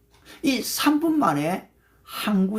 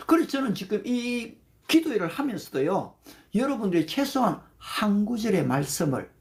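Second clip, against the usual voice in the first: ordinary delivery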